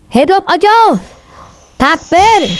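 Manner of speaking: high rallying cry; slogan call. Real, not acted. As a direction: lead the crowd with a high-pitched cheer. Leading the crowd in slogans. (pekik yel-yel bernada tinggi)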